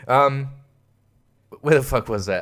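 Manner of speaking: normal talking voice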